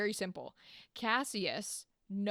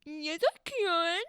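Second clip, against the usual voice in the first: put-on high, drawn-out whiny child voice; petulant baby; 6 semitones higher, slower than usual. Cassius answers Brutus like a child, petulant whine. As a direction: whine it slowly like a sulking toddler. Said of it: "like a petulant little baby child".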